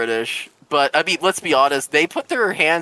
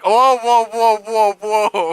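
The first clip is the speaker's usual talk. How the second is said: raised, loud imitation of a shocked crowd; mock alarm; 6 semitones higher, 6 dB louder, slower than usual. Everyone reacting to the band's boast, mock alarm. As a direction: cry out in mock protest, higher and louder.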